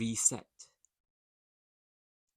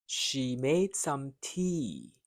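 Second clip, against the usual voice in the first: "She made some tea" said slowly, each word drawn out; 2 semitones higher, 3 dB louder, much slower than usual. Shown as a demonstration. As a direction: take it slow and clear for the learner. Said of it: "Repeat each line after me, first slow".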